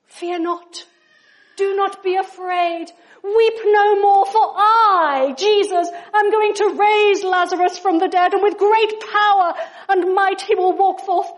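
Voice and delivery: booming, building movie-hero proclamation; superhero Jesus. Acted. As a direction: start measured, then swell to a loud, grand declaration.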